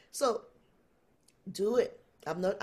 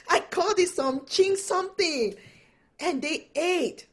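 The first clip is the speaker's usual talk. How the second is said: laughing; 9 semitones higher, 6 dB louder than usual. Real, not acted. Still laughing through the story.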